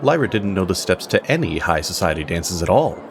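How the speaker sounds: usual speaking voice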